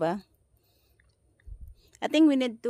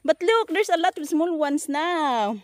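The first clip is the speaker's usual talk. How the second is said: high, louder, delighted; excited; 8 semitones higher, 4 dB louder than usual. Real, not acted. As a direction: bubble over with joy. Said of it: "I'm just get excited".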